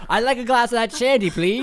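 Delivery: loud, high hammy customer voice; hammed-up order. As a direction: call out the order grandly. Ordering shandy, mock posh call.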